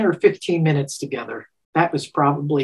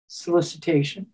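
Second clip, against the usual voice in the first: mock incredulous; 5 dB quieter than usual. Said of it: "George looked at me and went".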